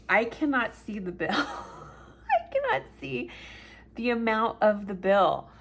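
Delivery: re-enacted laughing fit, pitched high; retold helpless laughter. Telling her friend she can't read the bill, laughing. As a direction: laugh through it, slowing as the pitch jumps. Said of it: "laughing so hard".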